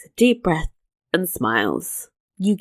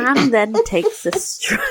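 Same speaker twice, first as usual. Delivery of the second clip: high, breaking up with laughter mid-sentence; real laughing. Real, not acted. Podcaster cracks up reading Anne's stroll, helplessly laughing. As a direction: try to read on and dissolve into giggles.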